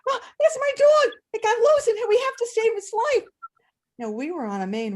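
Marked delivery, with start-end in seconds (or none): 0.1-3.3 high, loud, urgent panic; panicked plea. Retold or imitated. Boy telling teacher his dog got loose, panicked. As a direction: blurt it out, scared for the dog.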